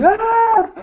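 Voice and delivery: sudden high yelp like a monster leaping out; monster jump-scare yell. Retold or imitated. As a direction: yell it like something jumping from the dark. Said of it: "Jack coming out like".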